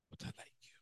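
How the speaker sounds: whispered, barely audible; ashamed whisper. Acted. Peter whispering his shamed answer to Jesus. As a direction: breathe the words out in shame.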